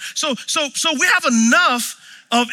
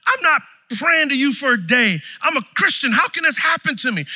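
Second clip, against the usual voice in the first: mad at God; 5 semitones higher than usual. Retold or imitated. Injured player ranting at God, retold angry shout. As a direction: shout your protest up at God. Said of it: "and so I was mad".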